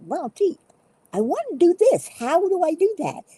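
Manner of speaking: high, naive questioning voice; puzzled beginner voice. Retold or imitated. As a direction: play a confused newbie. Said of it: "and you say".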